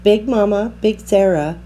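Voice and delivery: ordinary delivery, nothing marked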